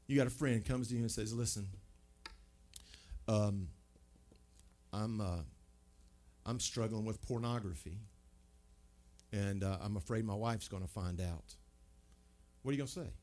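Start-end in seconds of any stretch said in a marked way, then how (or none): 1.3-11.6 softer, halting admission; a touch of shame, trailing quietly; hesitant confession. Acted. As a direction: friend nervously confessing pornography struggle. Friend confessing a struggle.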